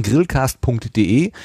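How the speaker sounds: slow, clearly separated syllables; careful web address. Real, not acted. Host giving listeners the podcast's web address, slow and careful. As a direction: spell out the address slowly and carefully. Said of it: "Nicht, dass wir die U R Ls hier wieder verschludern".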